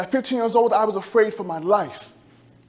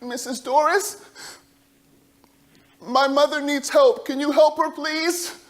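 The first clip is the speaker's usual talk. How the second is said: put-on higher, slow pleading child voice; a small boy begging for help; pleading little boy; 5 semitones higher, slower than usual. Boy begging neighbor for mother.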